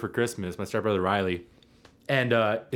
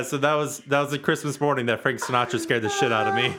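normal talking voice

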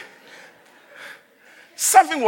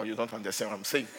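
ordinary talk

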